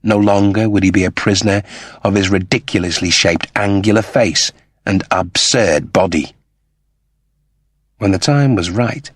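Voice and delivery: plain reading voice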